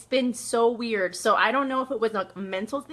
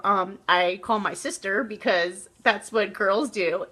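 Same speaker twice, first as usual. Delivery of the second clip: ordinary talk, nothing marked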